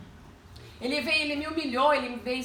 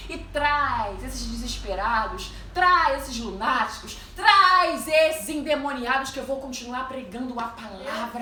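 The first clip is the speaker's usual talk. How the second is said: loud, drawn-out repeated "traz"; impassioned retold prayer; fervent preaching; 5 dB louder, slower than usual. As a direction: build the plea, hitting each "traz".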